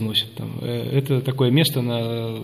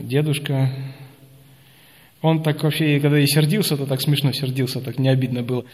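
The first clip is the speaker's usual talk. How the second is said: ordinary talk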